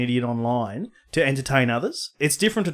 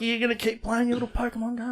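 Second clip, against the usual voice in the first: higher, quick mock nag; nagging wife; 8 semitones higher, 6 dB quieter, faster than usual. Imitating his wife nagging about Pokemon, higher mock nag. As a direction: needle him like a nagging wife. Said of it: "The wife's just like".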